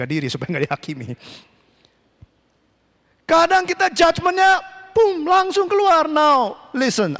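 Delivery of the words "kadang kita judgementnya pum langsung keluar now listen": high, drawn-out emphatic warning; slow down and drive the warning home; forceful preaching. Real, not acted. (khotbah lantang penuh penekanan)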